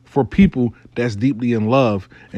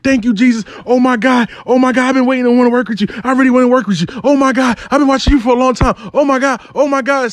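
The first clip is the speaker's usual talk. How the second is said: imitating hollering; 9 semitones higher, faster than usual. Client gushing to Melvin on phone, hollering with joy. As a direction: babble joyfully like she won the lottery. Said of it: "she over there hollering like".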